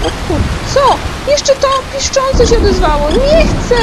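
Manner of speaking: very high, loud terrified shriek; shrieking panic. Real, not acted. Gamer hearing the detector squeal, shrieking in terror. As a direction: scream it like the monsters are right behind you.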